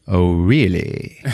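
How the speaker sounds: very slow, low and teasing; slow menacing drawl. Retold or imitated. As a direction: draw it out like a threat. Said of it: "he turns around and he's like".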